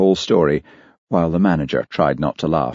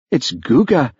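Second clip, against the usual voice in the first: proud host, pitched up; 8 semitones higher than usual. A narrator's reading. Charlie presenting his delicacy to Olivia, proud. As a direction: announce it like a prize dish. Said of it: "said Charlie proudly".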